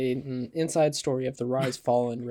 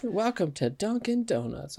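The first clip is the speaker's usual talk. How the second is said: put-on Boston accent; 7 semitones higher than usual. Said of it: "turned into a Boston movie".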